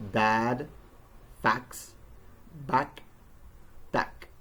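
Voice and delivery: "dad, fax, back, tack" with the wide-open vowel of hat. Shown as a demonstration. Teacher drilling the A in dad, open vowel in "dad, fax, back, tack". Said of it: "pronounced with a wide open mouth".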